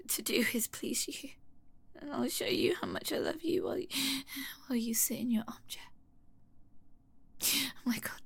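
meek whisper. Acted. Whispered submission.

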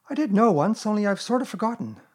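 put-on light, high boyish voice, casually offhand; mild carelessness. A narrator's reading. Boy admitting to Rabbit he has forgotten, careless. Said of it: "said Christopher Robin carelessly".

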